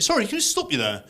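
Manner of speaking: high-pitched puzzled interruption; baffled English pal. Retold or imitated. English pal querying a Scottish phrase, baffled and high-pitched. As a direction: pull someone up, voice pitched high in disbelief. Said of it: "to one of my English pals".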